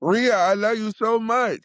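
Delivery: high, thrilled shout of love for Rhea; excited call-out. Real, not acted. Podcast cohost gushing over his favorite wrestler, excited high call. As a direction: gush at her like a fan.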